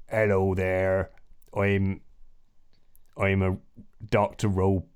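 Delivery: slow West Country robot doctor; Irish slipping into West Country. Acted. Robot doctor greeting a patient, slow accidental West Country accent. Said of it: "no, that's West Country".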